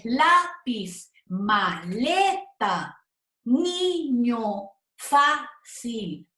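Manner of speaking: "lápiz, maleta, niño, fácil" slowly, LÁ, LE, NI, FÁ strong; stressed penultimate syllables. Shown as a demonstration. Teacher listing graves, stress on penultimate of "lápiz, maleta, niño, fácil". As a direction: split each word, lean on LÁ, LE, NI, FÁ. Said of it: "sino en la penúltima".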